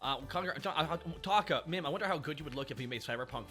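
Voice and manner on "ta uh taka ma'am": ordinary delivery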